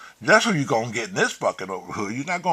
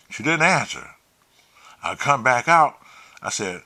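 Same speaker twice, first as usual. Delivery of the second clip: plain reading voice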